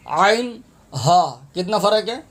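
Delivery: normal talking voice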